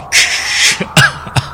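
breathy sinister cackle; cold laugh. Acted. Mother cackling coldly at her bound daughter. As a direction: chill them with a laugh.

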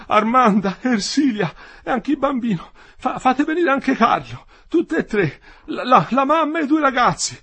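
high, loud, desperate cries, stammering; delirious. A narrator's reading. Dying worker calling for his family, delirious. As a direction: cry out the names in mounting frenzy. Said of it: "Ora già delirava".

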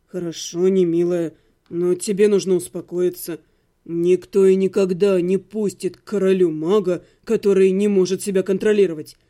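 low, steady and level; calm, even voice. A narrator's reading. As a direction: cut through her panic calmly. Necromancer calm, patient.

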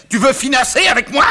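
high, loud warning question; angry challenge. Acted. Father challenges son.

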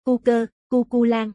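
"Cu cơ, Cu Cu Lan" said slowly, each name set apart; measured, deliberate sounding-out of "Cu cơ, Cu Cu Lan"; slow sound comparison. Acted. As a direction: draw out "Cu cơ" and "Cu Cu Lan" so their likeness is heard. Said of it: "Các anh thử nghe xem, hai âm này nghe rất giống nhau".

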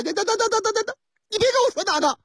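又高又急地连声打断; 演司机尖起调门嚷着抢话. Acted. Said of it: "你嚷嚷什么". (高调门急切嚷嚷)

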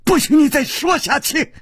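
high-pitched angry outburst; sudden, strained angry cry; furious roar. Acted. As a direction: cut them off in rage. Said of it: "他怒吼道".

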